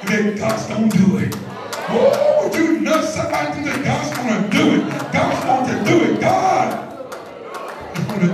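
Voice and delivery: loud, fast preaching cry; preacher rallying the congregation; preaching at full force. Acted. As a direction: proclaim it to the church. Sermon climax.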